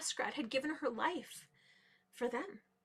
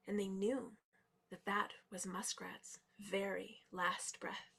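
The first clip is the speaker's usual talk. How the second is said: low, soft storytelling with long pauses; hushed, grave narration; 4 semitones lower, 7 dB quieter than usual. A narrator's reading. Animals wait for muskrat to return, hushed and grave. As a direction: let it sink almost to a whisper.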